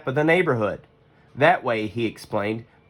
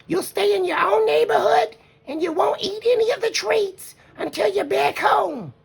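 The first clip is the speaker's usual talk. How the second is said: much higher, gentle mother bear voice; Mama Bear voice. Acted. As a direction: play the wise mother.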